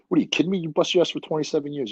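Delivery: incredulous outburst. Retold or imitated. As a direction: tell your friend he's crazy to quit. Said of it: "this is me talking to him".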